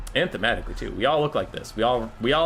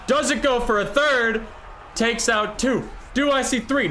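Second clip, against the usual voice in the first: loud, high-pitched match commentary call; excited play-by-play; 13 semitones higher, 3 dB louder, slower than usual. Real, not acted. Caster calling the Burning Head attack, excited play-by-play.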